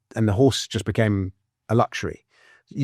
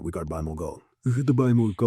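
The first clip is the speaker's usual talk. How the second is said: put-on light Russian accent; imitating a Russian central banker; 4 dB quieter, faster than usual. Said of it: "That's a very good Russian accent".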